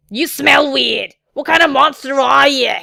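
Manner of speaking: put-on loud, bratty child accusation; hammed-up, very loud kid voice; accusing little girl. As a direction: blurt it rudely like a suspicious kid. Suspicious little girl accuses guest.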